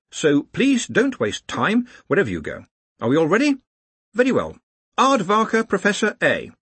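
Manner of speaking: high-pitched, careful briefing; brief the group in a high, anxious-to-be-orderly voice; high academic giving instructions; earnest organiser. Acted. Ponder briefs, calls names.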